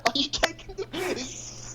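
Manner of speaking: high, slow, whiny British voice; hammed-up English whine; British accent. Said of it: "get a different accent".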